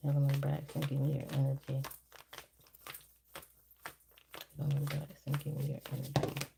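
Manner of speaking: hushed, repetitive incantation; spirit invocation. Real, not acted. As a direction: call on a spirit softly, fading as you repeat. Calls on spirit while reading cards.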